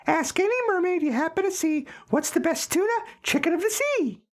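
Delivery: high, lilting ad-jingle voice; sing-song it like a tuna ad; a high mermaid voice pitching tuna. Acted. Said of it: "Daryl Hannah says".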